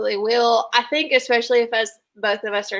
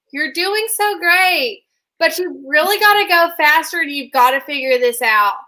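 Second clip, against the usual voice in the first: cheery coach voice; 5 semitones higher, 5 dB louder than usual. Retold or imitated. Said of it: "and she's like".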